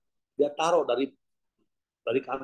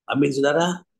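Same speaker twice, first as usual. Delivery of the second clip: usual speaking voice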